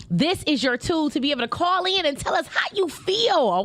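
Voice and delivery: usual speaking voice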